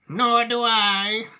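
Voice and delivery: put-on high, loud, drawn-out old woman; Grandma Georgina. Grandma agreeing she distrusts Wonka, high and drawn-out. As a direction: chime in slowly with a high granny voice. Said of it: "said Grandma Georgina".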